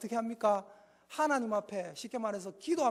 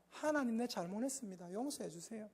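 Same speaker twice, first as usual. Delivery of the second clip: humble soft prayer; 2 semitones lower, 10 dB quieter than usual. Shown as a demonstration. Demo prayer of repentance.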